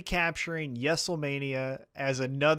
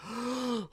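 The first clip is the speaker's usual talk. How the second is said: mock gasp; 6 semitones higher, 7 dB quieter than usual. Mock outraged reaction.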